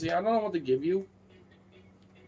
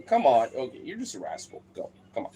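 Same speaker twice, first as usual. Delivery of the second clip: normal talking voice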